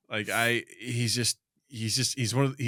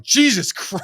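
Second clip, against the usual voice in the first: higher, louder startled exclamation; startled owner; 11 semitones higher, 7 dB louder, much slower than usual. Retold or imitated. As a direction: jolt as if the bark just made you jump. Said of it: "When he does, you're like".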